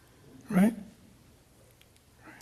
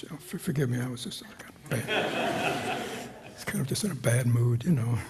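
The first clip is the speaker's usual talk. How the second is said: lower, subdued voice of someone apologizing; mock apology; 5 semitones lower, 4 dB quieter than usual. Teacher acting out someone apologizing after an offence, mock contrite. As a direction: offer a sheepish, low-key pretend apology. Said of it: "Twenty minutes later they apologize".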